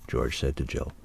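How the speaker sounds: ordinary delivery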